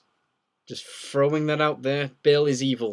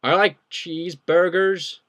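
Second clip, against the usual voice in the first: loud, slow, higher-pitched mock American; attempted American accent; 5 semitones higher, 6 dB louder, slower than usual. Shown as a demonstration. Brit tries an American accent for chat, exaggerated American accent. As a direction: put on a big, drawn-out American accent. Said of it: "do your best American accent".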